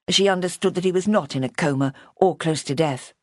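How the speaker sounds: plain reading voice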